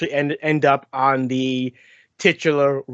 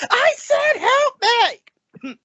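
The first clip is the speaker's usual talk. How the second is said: commanding shout; slower than usual. Retold or imitated. Jessica yelling at Droopy, commanding shout. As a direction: shout the repeat, out of patience. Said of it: "that deep, commanding voice".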